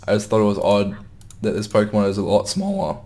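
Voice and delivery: ordinary talk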